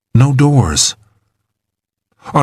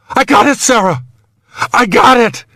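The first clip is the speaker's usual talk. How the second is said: high, breakthrough cry of success; excited relief; 13 semitones higher than usual. A narrator's reading. Trapped man tells rescuer he caught the hook, excited. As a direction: call up triumphantly in a high voice.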